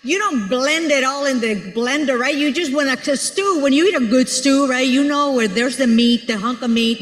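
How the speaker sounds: ordinary talk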